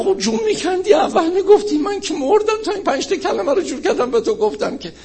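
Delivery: very high, strained comic complaint; mock exasperation. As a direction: whine it high, on the edge of laughter. Exasperated complaint in retold dialogue.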